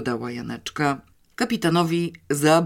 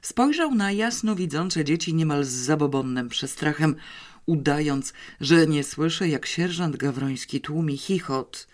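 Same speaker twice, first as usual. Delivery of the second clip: ordinary delivery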